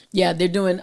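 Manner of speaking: ordinary talk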